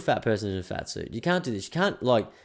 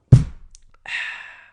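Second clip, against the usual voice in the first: loud breathy ahh after a drink; refreshed sigh; 10 dB louder than usual. Real, not acted. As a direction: savour the sip out loud.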